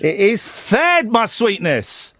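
high, slow mock-sweet retort; mock endearment. Host answering caller's "honey", mock endearment. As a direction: tease back with drawn-out, high endearments.

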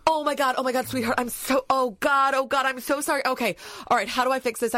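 high-pitched, gushing remorse; acted frantic apology. Wife imagining herself apologising to sweetheart, acted frantic remorse. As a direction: tumble out panicked, pleading apologies. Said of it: "I would have been going".

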